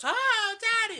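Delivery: high, loud mock child's wail; squeaky little boy crying for dad; hammed-up falsetto cry; Daniel. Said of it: "Then he goes".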